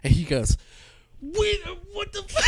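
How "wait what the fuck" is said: a much higher, strained, shouted outburst; a hammed-up outraged yell with a crying edge; a courtroom freak-out when he learns he was betrayed; mock freak-out. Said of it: "the most hilarious freak out".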